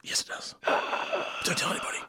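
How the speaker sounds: hushed unvoiced whisper with a gasp. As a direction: whisper it like a secret. Answering the secret in whispers.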